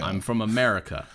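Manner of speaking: a lightly put-on American accent; easy fake American twang; mock American accent. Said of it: "Doctor Strange does his American accent".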